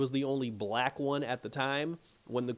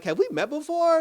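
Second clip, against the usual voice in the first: much higher, louder probing question; curious question; 6 dB louder than usual. Retold or imitated. Duke asks the disguised maid, high and curious. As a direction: fish for a memory, voice way up.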